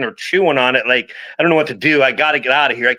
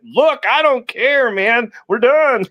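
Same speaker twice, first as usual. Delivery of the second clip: higher, slow, drawn-out dismissal; mock panicked refusal; 8 semitones higher, much slower than usual. Guide refusing his client near snakes, high slow mock panic. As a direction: stretch it out, too spooked to argue. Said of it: "I went".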